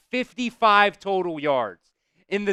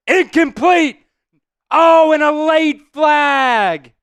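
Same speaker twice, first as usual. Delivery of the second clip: loud, higher, real excitement; excited shout; 8 semitones higher, 8 dB louder, slower than usual. Real, not acted. Fan calling the play live to viewers, shouting excitedly. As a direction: call the play out like you can't believe it.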